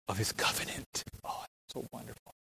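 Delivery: very soft, near-whispered wonder; hushed awe. Real, not acted. Preacher moved by God's covenant, hushed and awed. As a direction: let the voice drop away in reverence.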